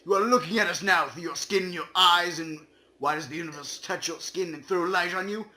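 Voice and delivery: ordinary talk, nothing marked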